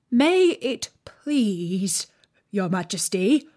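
slow, humble plea; humble gardener. A narrator's reading. Gardener pleading with the queen, humble. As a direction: beg on one knee. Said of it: "in a very humble tone".

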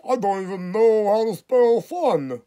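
high, loud, slow dopey mock voice; mocking the clerk. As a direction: ham up a dim cashier, drawing it out. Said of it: "this some fucking fat girl's like".

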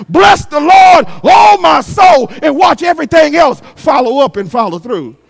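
loud, rising preacher's cadence; preaching at full force. Acted. Preacher rousing the church to praise, full force. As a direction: preach it out to the congregation.

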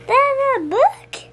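light up at the surprise; excited discovery. Real, not acted. Child discovers present.

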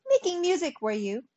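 high, loud scornful question, dropping at the end; disgusted cry. A narrator's reading. Ants rebuking the music-making grasshopper, disgusted. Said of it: "they cried".

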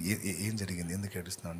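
calm her softly like a tender husband; gentle soothing. Retold or imitated. Husband softly comforting his weeping wife.

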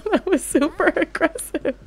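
high, laughing oh; cracking up at the screen; laughing shock. Real, not acted. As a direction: burst out laughing. Laughing at game.

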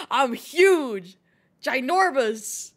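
loud, drawn-out proclamation; mock boasting. Streamer boasting of size to chat, mock bellow. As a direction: bellow it with playful grandeur.

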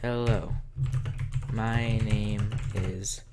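drawn-out words, typed as spoken; slow dictation while typing. Shown as a demonstration. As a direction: dictate the greeting as you type it to the viewer.

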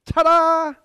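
very high, very loud shouted ta-da; a full-throated triumphant yell; top of your lungs. Shown as a demonstration. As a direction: belt it across the parking lot. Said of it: "at the top of your lungs".

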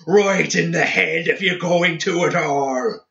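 high, frenzied, goading shout; bellow. A narrator's reading. Seamus goading Samael to shoot his head, bellowing. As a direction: keep bellowing the dare to the last word. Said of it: "he bellowed".

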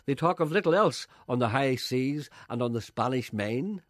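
tongue-in-cheek continuity announcer; announcer read. Acted. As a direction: read it like a pompous radio announcer. Presenter's scripted mock-grand link.

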